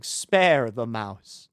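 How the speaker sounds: very slow, drawn-out spare; slow deliberate. Acted. Choosing mercy in the game, slowly drawn out. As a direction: stretch every word out.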